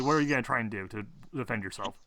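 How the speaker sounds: ordinary delivery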